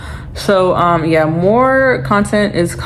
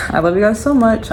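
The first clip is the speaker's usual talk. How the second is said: out of breath; 3 semitones higher than usual. Real, not acted. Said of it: "I'm out of breath".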